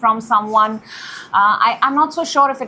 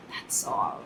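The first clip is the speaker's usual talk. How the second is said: quick breathy whisper aside; a little whispered sign-off; 14 dB quieter than usual. Real, not acted. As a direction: drop to a whisper for a throwaway line. Aside after thanking viewers.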